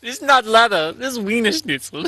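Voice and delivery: high mock German accent. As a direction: lay on a hammy German accent.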